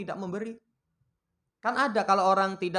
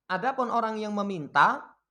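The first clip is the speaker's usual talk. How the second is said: usual speaking voice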